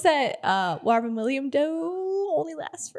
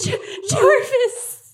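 dismayed cry; 10 semitones higher, 4 dB louder, much slower than usual. Acted. Player dismayed at Jarvis.

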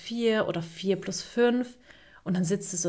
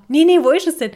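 much higher and louder panic; panicked dad; 8 semitones higher, 10 dB louder than usual. Retold or imitated. Mother imitating Murat frantically hunting his phone, mock panic. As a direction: yelp it like a dad who lost his phone.

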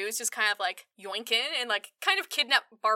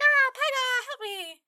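high mock cry for Pygar; hammed-up high plea for help; 14 semitones higher, slower than usual. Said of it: "Barbara's like".

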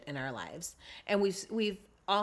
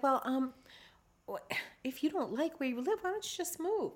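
higher, softer, hesitant condescension; mock-delicate rich relative offering advice; smug well-off relative; 6 semitones higher, 6 dB quieter than usual. Well-off relative advising on where you live, condescendingly.

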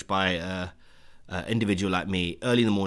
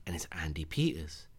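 whispering; 5 semitones lower, 10 dB quieter than usual. Real, not acted. Starstruck reveal.

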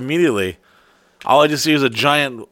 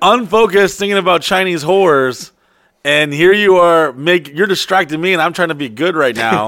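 raised, louder exasperated protest; mock scolding; 6 semitones higher, 5 dB louder than usual. Telling off a distracting friend, mock exasperated. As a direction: protest loudly in a higher voice.

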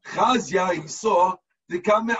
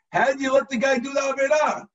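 indignant demand; 6 semitones higher than usual. Acted. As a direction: demand an answer from the text. Teacher challenging the text's logic.

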